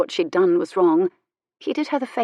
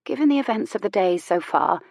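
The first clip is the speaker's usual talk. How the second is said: ordinary delivery, nothing marked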